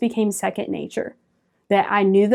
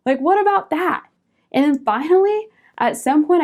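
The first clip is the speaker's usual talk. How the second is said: excited; 7 semitones higher than usual. Real, not acted. Excited aside about possibility.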